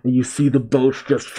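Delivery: ordinary delivery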